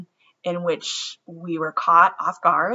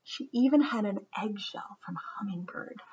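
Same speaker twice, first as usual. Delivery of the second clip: hushed storybook whisper; 2 semitones higher, 9 dB quieter than usual. A narrator's reading. Teacher reads to kids about a tiny treasure, whispering. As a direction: whisper it like a little secret.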